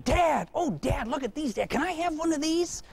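put-on high, eager little boy voice; excited boy. Boy begging his dad for souvenirs, high and eager. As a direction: pester like an eight-year-old.

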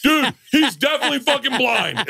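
high-pitched imitation of a friend yelling; calling out. Friend calling from the back, retold.

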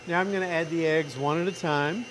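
ordinary delivery

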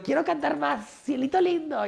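put-on high little-girl request; high-pitched eager child voice. Child demanding another song.

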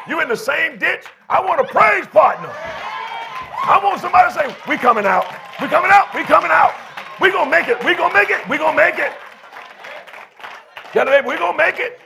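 high, shouted church preaching; full-force preaching. Real, not acted. Pastor rallies weary congregation to praise, full-force preaching.

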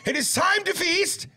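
high, hammed-up proclamation; mock feast herald. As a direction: proclaim it grandly, stretching the final words.

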